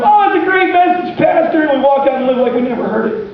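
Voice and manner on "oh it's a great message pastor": mock churchgoer. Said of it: "we do that in church sometimes".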